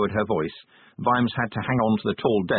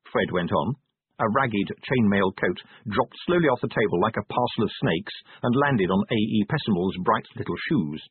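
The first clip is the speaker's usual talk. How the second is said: ordinary delivery, nothing marked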